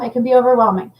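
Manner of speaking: normal talking voice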